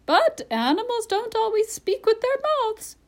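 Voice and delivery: very high, loud parrot; high-pitched, indignant bird. A narrator's reading. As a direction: protest in a piercing high voice. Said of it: "in a high voice".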